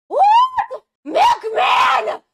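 loud high call. Real, not acted. Streamer calls out a chatter by name. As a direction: yell the name across the room.

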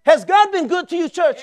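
loud, high call to the congregation; call to church. Real, not acted. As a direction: rouse the church for an answer. Preacher calling to congregation.

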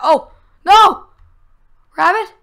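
sudden loud high alarm; startled cry. Real, not acted. Scared a teammate died, startled loud cry. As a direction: jolt of panic at a shape.

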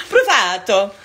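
Brufato in a loud, hammed-up Italian accent; playful Italian accent. Laughing at her own slip over a fan's name, mock Italian. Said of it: "I just made you Italian".

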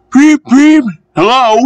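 very high, loud engine toot; cheerful whistle. A narrator's reading. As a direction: toot brightly like a train whistle. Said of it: "he whistled cheerfully".

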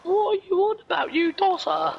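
squeaky, very high put-on voice; high-pitched mock retort. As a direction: push the voice right up in mock disbelief. Mocking retort to friend.

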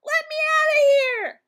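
very high, very loud squawking parrot; a shrieking parrot crying for help; parrot yelling. Acted. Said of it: "yelled the parrot".

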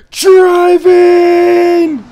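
high, loud shouted punchline. Real, not acted. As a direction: yell it out. Joking shout of own bad driving.

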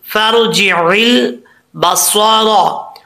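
फर्जिल बसारा very slow, with the lam held still and no bounce; slow Quranic recitation. Shown as a demonstration. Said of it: "वहाँ पे आवाज़ जम जाए". (धीमी तिलावत, लाम पर आवाज़ जमी हुई)

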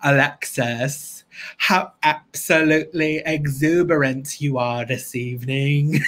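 slow, affected theatrical diva; Moira Rose. Acted. Fan does a Schitt's Creek impression, affected diva voice. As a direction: draw out the words grandly, like an eccentric diva. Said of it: "do my Moira Rose Schitt's Creek impression".

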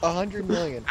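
talking through hiccups. Real, not acted. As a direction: hiccups breaking the words. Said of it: "I have the hiccups".

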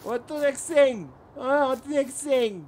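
high, drawn-out Norman holler; put-on Norman accent. Player teases his mate about the accent, put-on Norman accent. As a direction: stretch it out in a hammy Norman accent.

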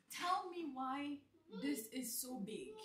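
ordinary delivery

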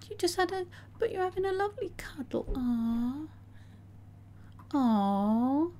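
high, soft whispered coo; hushed, tender and high. Real, not acted. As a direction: whisper fondly at the cuddling pair. Cooing at cuddling sims.

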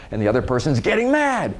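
usual speaking voice

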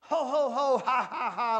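loud, high ho-ho and ha-ha; big mock laughter. A narrator's reading. Two lazy pigs laughing at their brother, loud mock laughter. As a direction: peal of storybook laughter. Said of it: "laughed the two lazy little pigs".

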